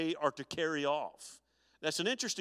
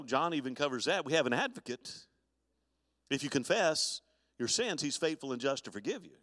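ordinary talk, nothing marked

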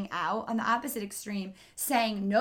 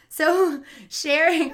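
laughing; 6 semitones higher, 9 dB louder, much slower than usual. Real, not acted. Laughs at own delivery.

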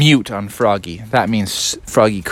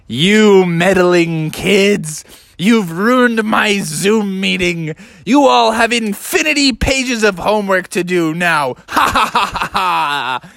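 slow, higher, hammed-up menace ending in a cackle; villainous teacher; 6 semitones higher than usual. Teacher punishing the class with homework, villainous gloating.

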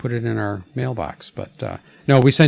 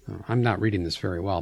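ordinary talk, nothing marked

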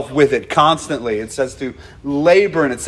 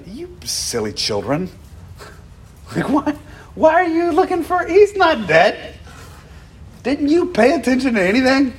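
much higher, mock-exasperated scold; high mock scolding of silly children; exasperated scolding angel; 10 semitones higher than usual. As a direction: chide them like silly kids, hammed up. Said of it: "It's almost like".

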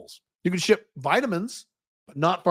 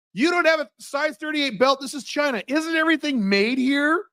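much higher, disbelieving tourist voice; incredulous tourist; 9 semitones higher than usual. Retold or imitated. Tourist asking why China has no belt his size, higher mock disbelief. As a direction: ask it in mock disbelief, pitched up. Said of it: "he goes".

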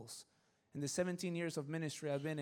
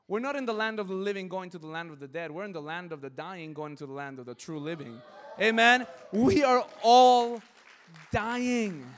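preaching at full force; 12 dB louder than usual. Acted. Pastor to the congregation, shouting the point about dying. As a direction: hammer it home to the congregation.

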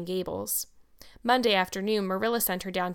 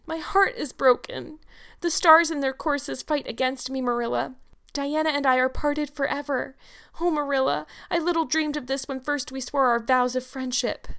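high, theatrical heartbreak; tragic lament; 6 semitones higher than usual. A narrator's reading. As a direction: declaim sorrow like a melodramatic girl. Girl laments lost friendship.

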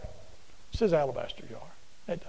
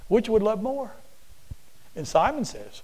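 ordinary talk, nothing marked

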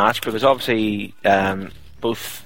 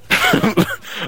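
a sudden shrill squeal of laughter; high squealing laugh. Real, not acted.

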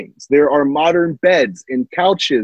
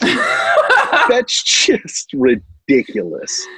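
laughing; 7 semitones higher, much slower than usual. Real, not acted. Laughing disbelief.

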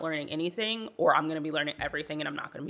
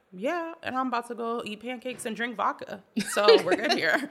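breezy brush-off; 7 semitones higher than usual. Retold or imitated. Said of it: "And I was like".

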